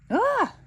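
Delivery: high, loud shriek of alarm; startled yelp. Acted. Terrier spooked by Winton standing too close, high loud shriek. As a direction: jump back with a yelp. Terrier runs from space invader.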